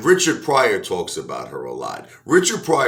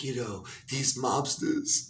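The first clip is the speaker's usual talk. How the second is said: crying singer; 4 semitones higher, 9 dB quieter, slower than usual. Retold or imitated. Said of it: "she's like".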